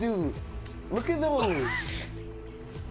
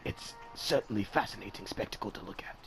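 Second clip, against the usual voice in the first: documentary narrator; 14 semitones lower, 10 dB quieter than usual. Acted.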